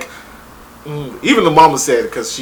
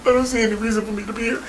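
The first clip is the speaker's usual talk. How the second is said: fast, soft mock of the hurt guy; deadpan mock guy; 5 semitones higher, 7 dB quieter, much faster than usual. Voicing the hurt guy as he walks out, fast and soft. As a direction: rattle it off quietly like him leaving.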